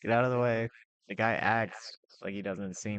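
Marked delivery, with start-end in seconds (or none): 0.0-0.7 higher, slightly louder mock yell; retold scream. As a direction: imitate yelling a warning from a bike. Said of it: "So, I start screaming".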